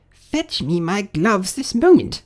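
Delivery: high, slow, imperious rabbit voice; bossy rabbit. Acted. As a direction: order the maid in a high character voice. Rabbit orders its maid about.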